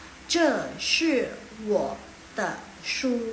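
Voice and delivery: slow, syllable by syllable Mandarin; slow model reading. Shown as a demonstration. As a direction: read it out slowly for the class. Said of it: "Yang kalimat pertama ya". (membaca pelan untuk murid)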